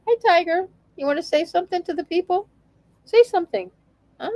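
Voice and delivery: high, coaxing pet voice; real high-pitched chat with a cat; talking to a pet. Real, not acted. Cooing to her cat.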